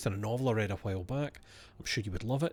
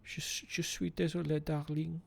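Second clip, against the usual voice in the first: higher-pitched put-on French accent; 6 semitones higher, 4 dB quieter than usual. Shown as a demonstration. Warming up to say darling, put-on French accent. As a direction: slip into French to find the accent. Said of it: "how a French person would say darling".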